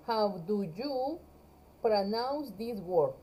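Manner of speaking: slow and careful, word by word; slow model sentence. Shown as a demonstration. Teacher reads the corrected question slowly to her students. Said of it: "¿De qué estamos hablando entonces?".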